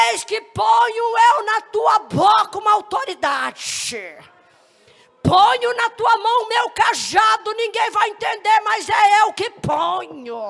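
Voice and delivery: high, loud, slow declamation; prophesying. Retold or imitated. God speaks through the woman to His servant, shouted prophecy. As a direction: proclaim it as God's own decree. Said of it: "tomou aquela mulher em profecia".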